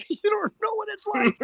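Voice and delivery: laughing as he mimics arguing with his wife; laughing protest. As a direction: repeat the complaint while cracking up.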